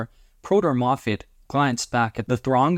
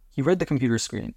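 usual speaking voice

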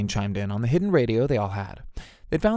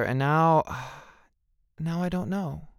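grieving; 5 semitones higher, slower than usual. A narrator's reading. Doug grieving the absent Charlene, faltering.

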